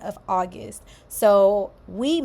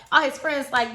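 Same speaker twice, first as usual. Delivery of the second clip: normal talking voice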